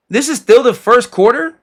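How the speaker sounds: can't believe it's still the first quarter; surprised disbelief. Real, not acted. Surprise at the game clock.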